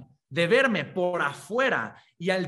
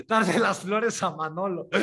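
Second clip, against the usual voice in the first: reading a comment through laughter; real laughter bubbling through; laughing; 4 semitones higher, 4 dB louder, slower than usual. Real, not acted. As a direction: laugh out the joke as you read it.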